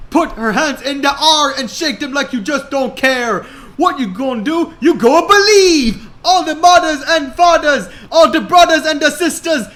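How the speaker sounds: high, loud, fast party hype; crowd hype. A narrator's reading. Akapos on stage hyping the crowd, loud and high. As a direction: pump the crowd up. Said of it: "picked up his mic".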